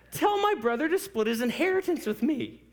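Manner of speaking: high, loud whiny holler; comic squeaky complainer shouting from the crowd; cracking-voice heckler. Acted. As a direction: yell it with your voice cracking. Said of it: "He's got a voice crack".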